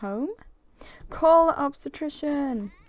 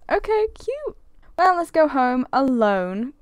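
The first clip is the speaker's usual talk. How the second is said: ordinary talk, nothing marked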